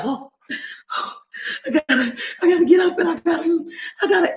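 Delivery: high, whimpering mock cry; hammed-up tearful whine about having to edit; mock whiny crying. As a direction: whine like you're about to cry over work. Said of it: "I be like".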